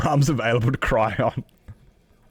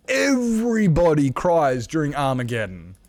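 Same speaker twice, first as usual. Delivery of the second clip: raised, emphatic exclamation; mock outrage; 4 semitones higher than usual. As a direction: declare it as an indignant protest. Mock-outraged rant about crying at films.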